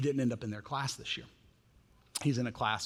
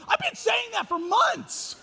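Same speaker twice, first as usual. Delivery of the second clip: high, drawn-out exasperation, louder; exasperated parent; 4 dB louder, slower than usual. Retold or imitated. Parent whose kid quotes another pastor, exasperated and high. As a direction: yelp it out in disbelief. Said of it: "You're like".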